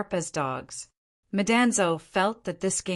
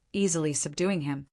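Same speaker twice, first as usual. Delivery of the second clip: plain reading voice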